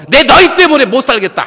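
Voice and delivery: high, shouted fed-up outburst; mock exasperation. Preacher acts what Jesus never told disciples, exasperated shout. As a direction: yell as if giving up on your followers.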